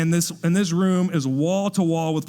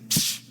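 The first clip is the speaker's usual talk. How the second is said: short hissed "shh"; dismissive shush; 4 dB quieter than usual. Retold or imitated. Ladies hushing the birthday speaker.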